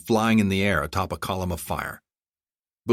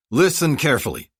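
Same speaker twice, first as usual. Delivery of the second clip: louder, higher stern warning; angry; 8 semitones higher, 5 dB louder than usual. A narrator's reading. Armored figure to bodyguards, leaning in, angry. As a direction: demand attention with controlled anger. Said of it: "the unearthly apparition said angrily".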